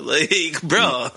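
normal talking voice

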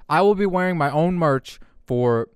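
ordinary delivery

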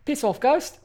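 tossing the line off lightly as Korg. Acted. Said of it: "I have to talk like Korg".

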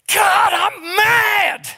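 high, slow, crying shout; preacher voicing a hurting believer's raw, crying yell at God; anguished cry to God. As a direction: cry it out to heaven from the gut. Acted outburst in prayer.

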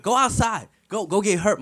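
put-on high, loud order to the kids; exasperated urging. Fed-up speaker to phone-bound kids, exasperated urging.

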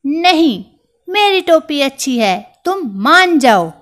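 high, loud insistence; a tiny mouse out-arguing the king; defiant little mouse. Acted.

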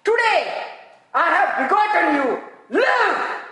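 high, loud, slow proclamation; a high commanding voice that ends on live; God declaring. Retold or imitated. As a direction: pronounce it from heaven with pauses. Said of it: "God says".

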